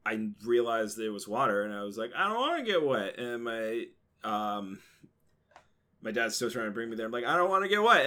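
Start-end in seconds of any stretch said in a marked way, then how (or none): 2.2-3.2 put-on scared kid whine. Scared kid refusing the ride. 7.3-8.1 put-on kid protesting. Kid insisting louder to dad.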